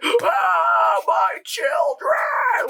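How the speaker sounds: high, loud, over-the-top wail; hammed-up sobbing shout; mock sobbing. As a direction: wail it drawn out in fake grief. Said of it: "like, sobbing".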